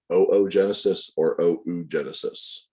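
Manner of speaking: first "Oogenesis" with oh-oh, second "oogenesis" with oh-oo; both correct pronunciations. Shown as a demonstration. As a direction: say "Oogenesis or oogenesis" as oh-oh-genesis or oh-oo-genesis. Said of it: "Either of those pronounce".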